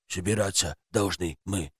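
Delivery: low quiet odd creature; toy creature voice. A narrator's reading. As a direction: speak low like a strange toy.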